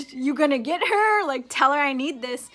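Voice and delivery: retold urgent plea. Retold pleading with her mother.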